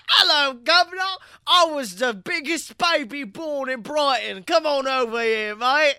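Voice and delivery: put-on loud, high-pitched, terrible British accent; fake British accent. Brighton local greeting Nicolas Cage, badly faked British accent. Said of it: "the worst fake British accent".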